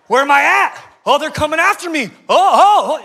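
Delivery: high, loud hammed-up alarm; acted frantic quarterback panic; mock panic. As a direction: yelp as if being chased. Acts out panicked quarterback fleeing defenders.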